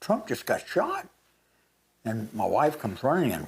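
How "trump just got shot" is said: high, urgent retold cry at talking volume; mock scream. Telling his wife of the shooting, restrained mock scream. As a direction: imitate breaking shocking news, voice pitched up. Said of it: "I screamed out to my wife".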